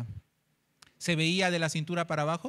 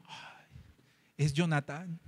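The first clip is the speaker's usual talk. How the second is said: soft sighed gasp, then tender; mildly acted wonder at a beloved face; loving recognition; 3 semitones lower, 5 dB quieter, slower than usual. David sees his friend's son, soft loving recognition. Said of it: "vio al que ama".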